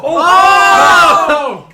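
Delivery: loud high shout of realization; big excited group whoop; excited outburst. Real, not acted. Excited reaction to the hint.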